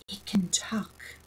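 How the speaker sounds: hushed, slow solemn whisper. A narrator's reading. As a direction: whisper it gravely, like a secret. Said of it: "said Hea solemnly".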